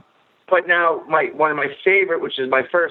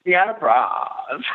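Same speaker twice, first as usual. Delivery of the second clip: teasing imitation of her accent, very drawn out; mimicked accent with a long, broad vowel in Braz; Deanna's accent; much slower than usual. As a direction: stretch the name slowly in a mimicked accent. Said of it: "it's 'cause the way she talks".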